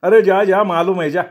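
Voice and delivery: high, loud, scornful shooing; dismissive yell. Acted. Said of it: "शेठजी बसल्या जागेवरनं पुन्हा ओरडला". (ओरडून हाकलत)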